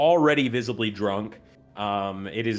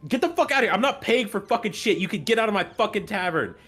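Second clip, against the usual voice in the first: barmaid shouting; 10 semitones higher, faster than usual. Acted. Barmaid Lilia throws the broke drunk old man out, furious shout.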